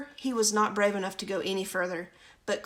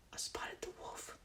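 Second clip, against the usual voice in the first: soft whispered aside; 17 dB quieter than usual. Real, not acted. Reader pointing out the wolf, whispered. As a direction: whisper it like spotting danger. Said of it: "aside to listener".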